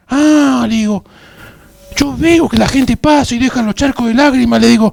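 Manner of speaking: loud, high-pitched indignant outburst; scandalised. Retold or imitated. Man telling instructor about people crying at church, scandalised. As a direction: let it burst out high and loud in disbelief.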